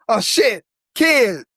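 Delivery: high-pitched acted shock, dropping away; mock dismay. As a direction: imitate a shooter's horrified realization. Imagining Punisher realizing whom he shot.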